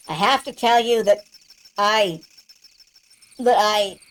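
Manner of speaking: halting, broken by pauses; faltering confession. Acted. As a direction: struggle to get the confession out, stalling on each try. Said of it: "Your voice is trailing off".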